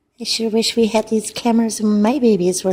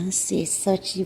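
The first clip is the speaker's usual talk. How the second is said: normal talking voice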